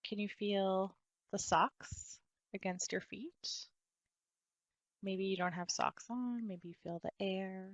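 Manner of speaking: soft body-scan voice with long pauses; guided meditation. Real, not acted. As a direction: gently guide attention through the body, softly and unhurried.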